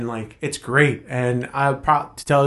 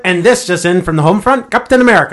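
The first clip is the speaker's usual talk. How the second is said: radio announcer; 5 semitones higher, 8 dB louder than usual. Pretend. Riffing as old radio announcer.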